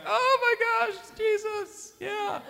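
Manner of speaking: high, slow, teary mock sob; wife overwhelmed, voiced high and teary; imitating crying. Husband imitating his wife moved to tears, high and slow. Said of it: "Emily starts crying like".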